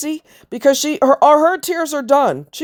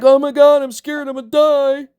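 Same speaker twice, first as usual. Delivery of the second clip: mock scared whine; 4 semitones higher than usual. Mocking dying husband's fear.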